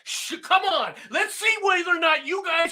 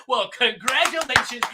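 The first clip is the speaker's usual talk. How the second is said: ordinary talk, nothing marked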